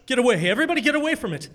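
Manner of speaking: alarmed warning. Acted. Parent warns family away from the skeleton, alarmed order. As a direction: order them back in alarm.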